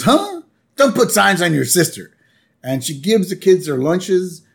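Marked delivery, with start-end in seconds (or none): 0.0-2.1 high, scolding mom voice; lightly voiced cartoon mom scolding; Marge scolding. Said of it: "She goes".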